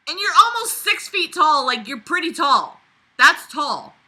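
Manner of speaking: high, loud pushback; loud disbelieving retort; incredulous protest. Real, not acted. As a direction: argue with the book. Arguing with narrator.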